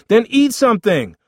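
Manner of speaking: loud, high-pitched retort; exasperated. Acted. Older brother fed up with hungry boy, exasperated. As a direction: shout back in the squabble.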